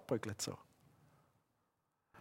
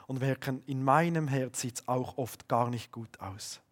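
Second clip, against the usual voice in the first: ordinary delivery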